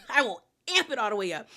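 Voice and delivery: fast, laughing repeat. Shown as a demonstration. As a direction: laugh it out quickly, amping it up.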